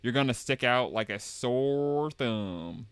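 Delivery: slow, stretched Southern drawl; Southern accent. Acted. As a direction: drag the words out, Southern style. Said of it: "That's also Southern speak".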